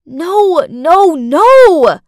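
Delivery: loud, rising no no no; desperate denial. Acted. Stepmother protesting her innocence, loud and desperate. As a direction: cry out against the lie.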